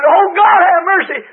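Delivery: high, loud, crying plea to God; retold terrified scream. Preacher reliving the vision, screaming to God in terror. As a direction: cry out in terror as the vision ends.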